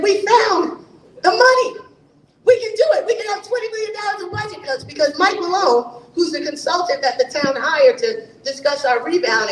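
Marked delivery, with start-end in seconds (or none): 0.0-4.8 excited. Retold or imitated. Council members retelling a meeting where they found money, excited. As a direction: burst out with the excitement of the find. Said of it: "we got so excited".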